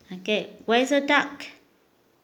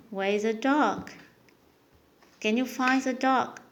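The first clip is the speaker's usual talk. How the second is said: ordinary talk, nothing marked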